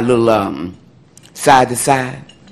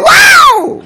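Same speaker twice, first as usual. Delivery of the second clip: shrieked high, loud and laughing; excited wow; 10 dB louder than usual. Retold or imitated. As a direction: let a thrilled girl's wow burst out. Amazed reply to Speedo's call.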